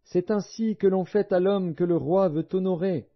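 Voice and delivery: high-pitched herald's cry, held steady; proclaiming. A narrator's reading. Aman leads Mardochée through the city square, proclaiming. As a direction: announce it to the whole square, high but not shouted. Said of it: "et il cria devant lui".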